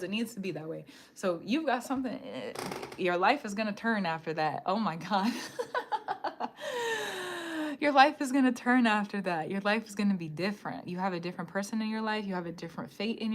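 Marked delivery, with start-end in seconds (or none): 5.4-7.8 high, drawn-out laugh; real high-pitched laughing fit; long laugh. Real, not acted. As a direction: burst out in a high cackle that trails on. Reacting to her own reading.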